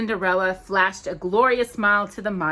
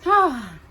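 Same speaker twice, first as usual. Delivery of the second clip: dreamy sigh; 7 semitones higher, 7 dB louder than usual. Acted. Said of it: "dreamy expression on her face".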